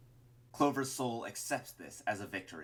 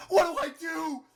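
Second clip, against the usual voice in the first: high, screamed plea; desperate plea; 12 dB louder than usual. A narrator's reading. Melting Martlet with nowhere to turn, desperate plea. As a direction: plead loudly in total desperation. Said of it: "she pleads desperately".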